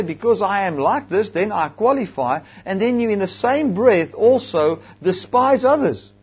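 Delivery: high, loud, driving preacher's cry; full-force preaching. Acted. Preacher rebuking the proud congregation, in full-force preaching.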